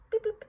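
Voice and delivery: high mock robot beeps; robot beep boop. To the viewers, a high playful robot beep. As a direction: chirp it like a little machine.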